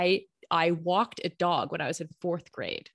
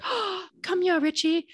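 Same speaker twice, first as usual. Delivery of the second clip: excited call; 9 semitones higher, 3 dB louder than usual. Retold or imitated. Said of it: "I got excited and I was like".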